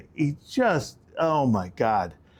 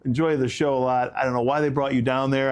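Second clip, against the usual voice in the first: normal talking voice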